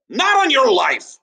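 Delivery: high, loud flat refusal; high firm refusal. Acted. Tom refusing the class palm tree, high and loud. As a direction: refuse it with a shout. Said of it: "Tom took one look and said".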